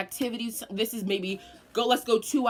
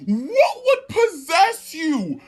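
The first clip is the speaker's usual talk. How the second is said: higher, louder, slow scolding grandma; outraged grandma; 5 semitones higher, 5 dB louder than usual. Retold or imitated. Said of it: "She was like".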